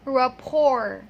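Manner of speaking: "rapport" stretched loudly as ra-POR; correct silent T. Shown as a demonstration. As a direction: end "rapport" on OR, no T. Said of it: "one more time".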